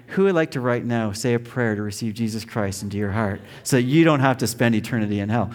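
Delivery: ordinary talk, nothing marked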